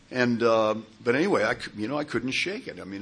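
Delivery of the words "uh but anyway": ordinary talk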